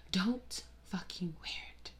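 slow, hushed don't; whispered warning to neon wearers; whispered command. Acted. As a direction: whisper the order slowly. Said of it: "stern request".

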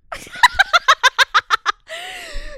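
shrieking burst of laughter; loud high laugh. Real, not acted. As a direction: cackle loudly at the pun. Laughing at Pratt pun.

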